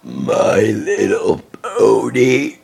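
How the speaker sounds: low, slow words pushed out on a burp. Shown as a demonstration. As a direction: talk through one long burp. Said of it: "I'm gonna do another burp video".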